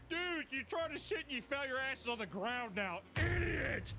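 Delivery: high, taunting mock shout; mocking jeer. Prankster mocking someone who fell on the ground, mocking jeer. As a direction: jeer at the fallen victim.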